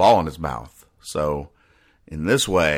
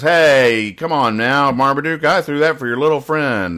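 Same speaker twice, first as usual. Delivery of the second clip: higher, louder put-on owner voice chiding the dog; mock dog owner; 6 semitones higher, 4 dB louder than usual. Owner calling out to Marmaduke, higher and louder mock chiding. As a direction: play the owner scolding Marmaduke in a raised voice. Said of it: "and he goes".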